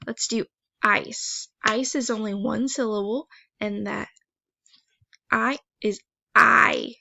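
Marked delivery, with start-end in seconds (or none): 5.3-7.0 I is I, slow and louder, I drawn out long; long i sound. Shown as a demonstration. Teacher showing students the long I says its name. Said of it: "It says its name".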